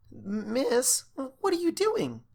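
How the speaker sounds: high, slow uneasy cashier; uncomfortable. Acted. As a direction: ask nervously, unsure. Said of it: "the cashier mare asked uncomfortably".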